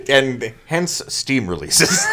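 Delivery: laughing through the joke. Real, not acted. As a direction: laugh while you say it.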